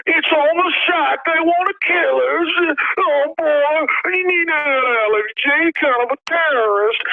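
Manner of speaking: high, slow, whiny mock police voice; mock whiny cop. Host mocking police claiming victimhood, high and whiny. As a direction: play the cop as a whining fake victim.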